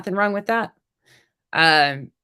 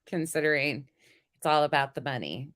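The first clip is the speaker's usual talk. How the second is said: usual speaking voice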